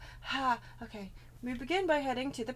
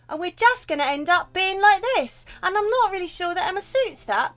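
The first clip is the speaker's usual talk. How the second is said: street urchin voice; 6 semitones higher, 8 dB louder than usual. Shown as a demonstration. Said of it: "it's sort of my street urchin voice".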